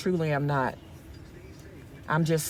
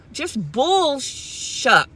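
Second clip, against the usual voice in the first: drawn-out mock curse; 6 semitones higher, much slower than usual. Real, not acted. Angry rant, softened curse.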